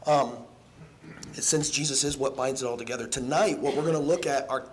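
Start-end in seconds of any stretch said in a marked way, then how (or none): none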